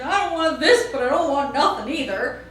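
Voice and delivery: stubborn, nostrils flaring; sulky kid. Retold or imitated. Boy's sulky thought.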